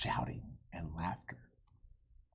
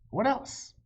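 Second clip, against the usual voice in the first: excited question; 13 semitones higher, 6 dB louder than usual. Acted. Annie voiced, eager question.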